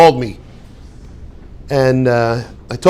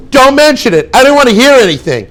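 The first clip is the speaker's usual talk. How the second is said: higher, louder, shutting them down; irritated brush-off; 6 semitones higher, 5 dB louder than usual. Shown as a demonstration. Teacher voices the hidden meaning, a louder irritated brush-off. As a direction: say it like you've heard enough.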